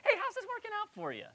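a sweet-sounding jab with a bite; cruel friendly jab. Retold or imitated. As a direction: fake friendliness with a sting. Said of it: "in a kind of a cruel, friendly way".

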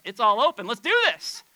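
high, loud burst of eagerness; retold can-do excitement; gung-ho young convert. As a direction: imitate your overeager younger self. Retells eager young convert's zeal.

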